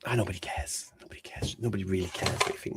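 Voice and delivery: ordinary talk, nothing marked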